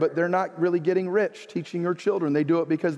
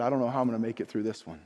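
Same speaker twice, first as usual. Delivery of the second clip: quiet, quick worry; 9 semitones lower, 7 dB quieter, much faster than usual. Retold or imitated. Quoting grieving widow Gina.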